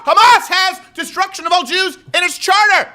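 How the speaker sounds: very high, loud, rapid-fire refusals; shouted rebuttal. Real, not acted. Host rejecting the U N comparison, high-pitched outraged shouting. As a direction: cut the argument off in comic outrage.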